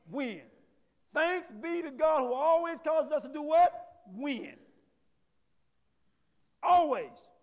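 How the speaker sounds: loud, slow, high-pitched sermon call; preaching. Real, not acted. Preacher driving home the verse to the congregation, preaching loudly. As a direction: preach it hard, ringing out the questions.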